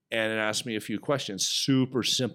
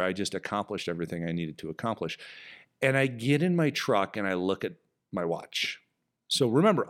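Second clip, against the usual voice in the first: ordinary delivery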